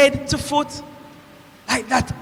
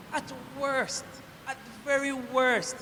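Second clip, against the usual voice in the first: much softer, thinking aloud; hushed pondering; 12 dB quieter than usual. Acted. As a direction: drop the voice and weigh it carefully. Weighing who the man was.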